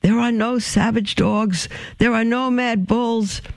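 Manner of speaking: mock yell pitched high, no real volume; thundering, held back. A narrator's reading. Farmer calling after the fleeing girls, understated thundering. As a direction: call it out high without shouting. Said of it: "a voice thundered".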